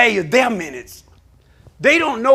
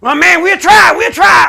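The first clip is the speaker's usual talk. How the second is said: higher, louder pleading caller; pleading shout; 8 semitones higher, 9 dB louder than usual. Retold or imitated. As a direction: beg him to come. Caller promising to try.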